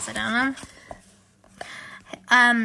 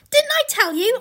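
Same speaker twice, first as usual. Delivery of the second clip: high, excited storybook voice; acted gleeful triumph; triumphant Mister Beaver; 12 semitones higher, 5 dB louder than usual. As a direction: crow it as one proved right. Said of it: "answered Mister Beaver".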